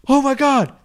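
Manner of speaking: squealing fan, way up high; overacted starstruck gasp; bad acting. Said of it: "Worst acting ever".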